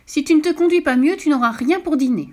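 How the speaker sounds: high, loud scolding; angry fox. Acted. The angry fox threatens the cow with no dinner, scolding loudly. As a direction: scold like a cross parent. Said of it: "ça mit le renard en colère".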